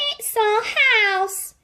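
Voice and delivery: tiny squeak of a worried mouse; squeaky mouse. A narrator's reading. The mother mouse telling George her trouble, squeaking. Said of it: "squeaked the mother mouse".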